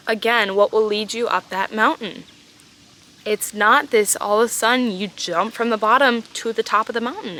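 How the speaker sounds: ordinary talk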